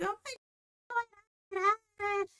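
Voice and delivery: very high, small mock-worried voice; halting, high-pitched acted alarm; concerned. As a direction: imitate a friend gasping out her concern. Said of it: "She's over there concerned".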